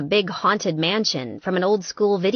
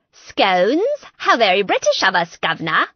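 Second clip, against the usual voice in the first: a hammed-up, louder fake British accent; bad British accent; 5 dB louder than usual. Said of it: "that was the worst accent I've ever heard".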